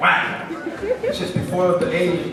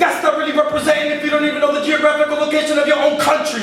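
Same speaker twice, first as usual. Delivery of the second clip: driving slam poem delivery, higher and louder than chat; spoken word poetry; 11 semitones higher, 4 dB louder than usual. Acted. Poet to the audience about the children of the wind, rhythmic slam delivery.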